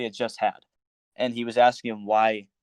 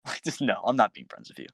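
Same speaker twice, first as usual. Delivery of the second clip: ordinary delivery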